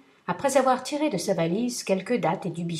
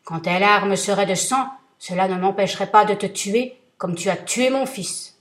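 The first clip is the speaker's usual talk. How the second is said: booming, much louder threat; thundering vengeful genie; terrible genie voice; 5 dB louder than usual. Acted. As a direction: roar it down at him like a giant. Genie rejects tears.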